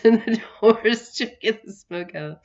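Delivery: ordinary talk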